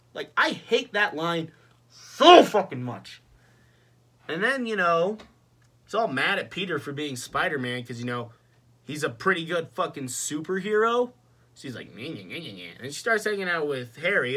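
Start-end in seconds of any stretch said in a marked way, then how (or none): 0.4-3.2 loud, slow, emphatic venting; vehement hatred. Real, not acted. Vents hatred of the line. 11.9-12.8 low-key sing-song whiny mimicry; mock a whiner with quiet nyeh noises. Mocks Mary Jane's whining.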